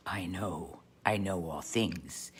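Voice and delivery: low, soft and slowing; an all-knowing cloud, quiet and low; hushed voice of God. Acted. God the cloud says he knows, hushed, low and slow.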